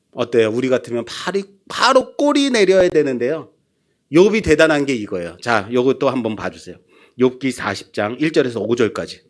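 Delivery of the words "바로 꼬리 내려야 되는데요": ordinary talk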